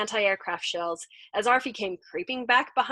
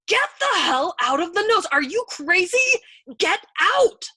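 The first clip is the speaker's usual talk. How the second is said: high, loud, outraged yelling; amazed scream; 10 semitones higher, 9 dB louder than usual. A narrator's reading. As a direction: scream at him to clear out. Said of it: "he screamed at Arfi in tortured amazement".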